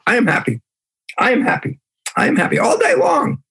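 high, insistent mock affirmation; faking happiness. Lecturer demonstrates repeating a happy mantra, high and put-on. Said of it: "you just say".

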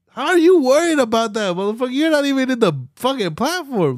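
high-pitched indignant questioning; incredulous outrage. Real, not acted. Ranter calling out people worried about a platform, incredulous outrage. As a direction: demand it, pitch jumping up in disbelief.

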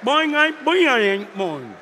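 boing sound effects. Pretend. Preacher mimicking the book spreading worldwide, bouncy boings. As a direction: toss off a few bouncy boings that sink at the end.

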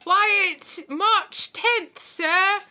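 high, loud mock street urchin; over-the-top little boy voice, shouted up high; Christmas Carol kid. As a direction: pipe up as an eager Dickensian boy. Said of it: "he's gonna be like".

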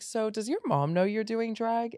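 high, sassy voice for a friend's question; imitating friend Ali. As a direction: ask it as a teasing friend.

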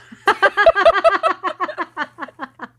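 big high burst of laughter; laughing hard at herself; loud laugh. Real, not acted. Laughs at own accent.

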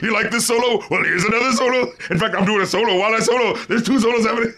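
mock show-off guitarist. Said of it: "It's like".

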